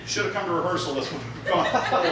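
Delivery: usual speaking voice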